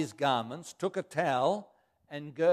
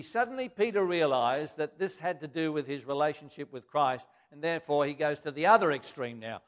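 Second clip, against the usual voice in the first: ordinary talk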